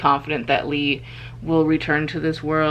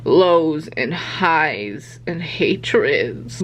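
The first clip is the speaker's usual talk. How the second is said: slow dramatic; 2 semitones lower, much slower than usual. Real, not acted. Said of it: "this is so emotional for me".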